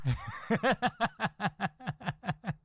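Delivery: high breathy gloating laugh; wife's cackle. Retold or imitated. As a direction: cackle in triumph. Wife cackles.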